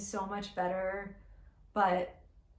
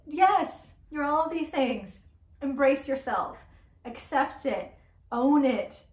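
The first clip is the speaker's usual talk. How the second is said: loud, high, emphatic pep talk; exasperated retort; 5 semitones higher, 6 dB louder, slower than usual. Acted. Reviewer answers whiny heroine back, loud exasperated retort. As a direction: punch each phrase, fed up and insistent.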